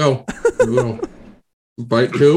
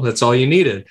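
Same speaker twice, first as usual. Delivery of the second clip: ordinary talk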